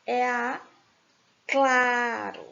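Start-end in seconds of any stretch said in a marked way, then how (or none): 1.5-2.5 "claro" with CLA held long; drawn-out CLA, light falling "ro" in "claro"; stress on CLA. Shown as a demonstration. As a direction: stretch the first syllable of "claro". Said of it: "Penúltima sílaba".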